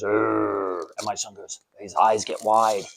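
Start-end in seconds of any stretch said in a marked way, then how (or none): 0.0-0.8 low, soft drawn-out "urrr"; mock haunted-house growl, kept quiet; imitated monster groan. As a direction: groan like a half-hearted scare actor. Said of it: "the guy comes over and goes".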